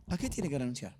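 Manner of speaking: low, softened question to the congregation; quiet question. Real, not acted. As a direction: drop the voice and ask it softly.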